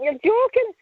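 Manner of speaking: a high incredulous "you're joking?"; mock shocked pal; retold disbelief. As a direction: pitch jumps way up in disbelief.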